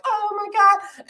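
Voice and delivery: high, slow imitating oh my God; imitating Demi's high surprised reaction; mock surprised gasp. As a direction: squeal it like a touched birthday girl. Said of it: "And she's like".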